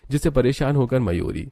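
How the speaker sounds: ordinary delivery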